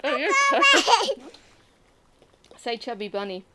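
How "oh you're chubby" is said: high, loud laughing exclaim. Real, not acted. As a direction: burst out laughing at them. Laughs at stuffed player.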